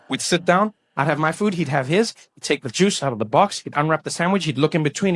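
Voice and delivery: normal talking voice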